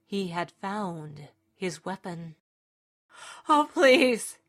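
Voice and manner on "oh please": high, loud desperate plea; crying out. A narrator's reading. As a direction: cry out into the dark, begging. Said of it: "he cried out to the darkness".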